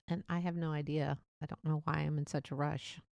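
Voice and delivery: usual speaking voice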